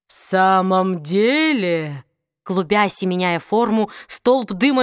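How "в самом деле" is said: low, slow demonic sneer; a slow low sneer from the dark; mocking demon. Demon mocks the mage.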